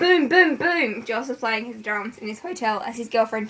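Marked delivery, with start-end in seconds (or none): 0.0-1.0 loud, higher drum booms; mimicking drum beats; drum sound. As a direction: beat it out like drums. Imitating drums in story.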